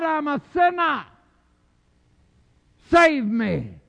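high, loud rising sermon cry; preaching at full force. Acted. Preacher builds to climax.

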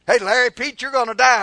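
loud, high playful announcement; mock cheerful call. Preacher mock-encouraging members with dying, playful shout. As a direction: call it out as if it were good news.

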